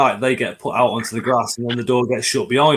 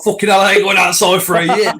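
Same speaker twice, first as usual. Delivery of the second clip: mock panic; 7 semitones higher, 7 dB louder than usual. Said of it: "It's, it's like".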